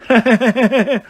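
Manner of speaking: high, loud staccato cackle; hammed-up ha-ha-ha; mocking laugh. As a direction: laugh loudly at your brother. Triplet laughs at his insult.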